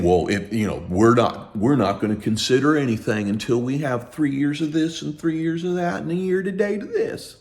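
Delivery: mocking snooty bank reply; high mock snooty bank voice; snotty bank email. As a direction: sneer the bank's excuses back. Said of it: "send a snotty ass email saying".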